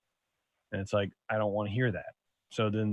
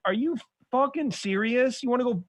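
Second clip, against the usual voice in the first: exasperated; 11 semitones higher than usual. Retold or imitated. Exasperated dad quote, much higher.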